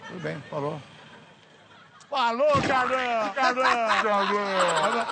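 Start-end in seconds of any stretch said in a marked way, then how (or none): 2.1-5.1 slow drawn-out loud farewell call. Acted. Prankster shouting goodbye at a stranger, drawn out. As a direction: call after him as he walks away. Calls after the man.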